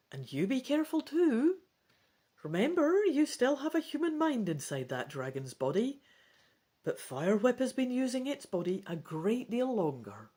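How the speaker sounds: caring caution in a character voice; a hint of a worried dragon; lightly voiced dragon warning. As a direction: warn them to be careful, kindly. Defal warns the hero.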